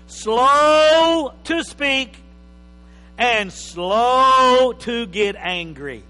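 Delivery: slow, loud, drawn-out emphasis on slow; preaching emphasis. Acted. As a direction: hammer the word slow.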